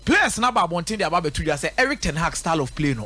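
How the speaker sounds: usual speaking voice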